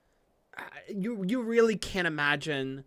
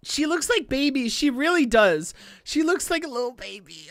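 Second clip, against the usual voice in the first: cooing; 7 semitones higher than usual. Real, not acted. Fan cooing to viewers over a cute character.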